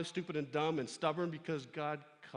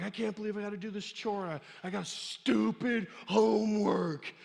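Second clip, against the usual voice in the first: whiny complaint; 5 semitones higher, 8 dB louder than usual. Pretend. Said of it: "you may have an attitude of like".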